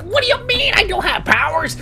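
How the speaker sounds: high, incredulous mock outcry; hammed-up indignant alarm; alarmed protest. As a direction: protest in disbelief, voice shooting up. Acted alarmed protest to hero.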